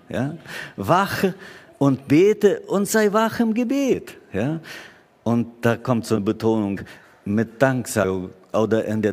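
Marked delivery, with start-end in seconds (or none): 0.8-4.2 high, ringing exhortation; preaching. Acted. Pastor repeating the call to watch, ringing exhortation. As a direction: call out the command to pray.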